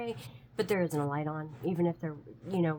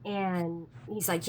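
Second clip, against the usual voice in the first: ordinary delivery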